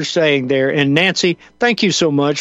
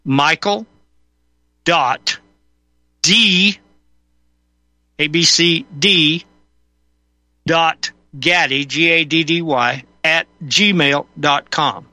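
slow, careful spelling out; over enunciating; slower than usual. Shown as a demonstration. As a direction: spell each letter out clearly. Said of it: "Repeat that one more time slowly".

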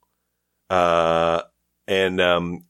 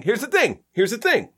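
higher, insistent here's the thing; emphatic buildup; 14 semitones higher than usual. Real, not acted. Defending DVD collection, insistent and higher. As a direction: rev up for a rant. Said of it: "emphatic lead-in".

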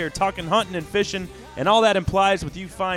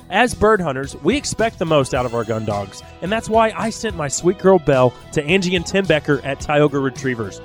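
ad read; 5 dB louder than usual. Acted. Host reads sponsor ad.

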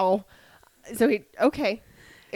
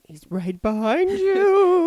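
slow, higher, drawn-out tease; mock spooky warning; 8 semitones higher, slower than usual. Co-host interrupts to say Val is there, mock spooky warning. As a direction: stretch it out like a playful ghost-story warning.

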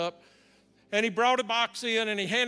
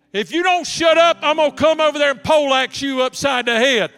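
loud, high yell of a threat; Vanessa yelling at roommate; 4 semitones higher, 12 dB louder than usual. Retold or imitated. Vanessa threatens the noisy lady in the next bed, yelling. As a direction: holler it across the room, pitched up. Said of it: "Her response was".

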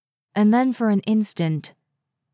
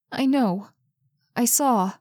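frightened Carmen; 4 semitones higher than usual. A narrator's reading.

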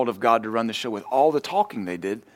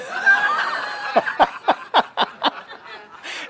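real burst of high laughter; high shrieking laugh. Real, not acted. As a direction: lose it laughing. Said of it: "It's just so funny".

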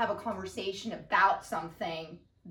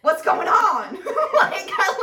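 laughing; 9 semitones higher, 11 dB louder, slower than usual. Retold or imitated. Acting out her own reaction.

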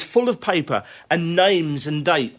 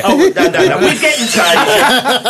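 high, loud, real laughter through the words; laughing protest; 7 semitones higher, 6 dB louder than usual. Real, not acted. Guest answering host's tease about Asia, laughing protest. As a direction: protest while cracking up.

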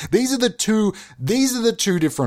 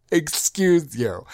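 sassy retort; 3 semitones higher, much slower than usual. Acted.

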